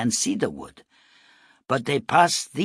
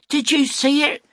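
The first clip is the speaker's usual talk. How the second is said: high, loud excited yell; 6 dB louder than usual. Acted. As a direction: shout it out running up. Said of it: "she yelled".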